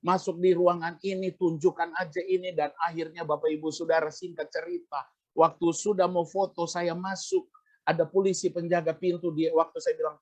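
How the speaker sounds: ordinary delivery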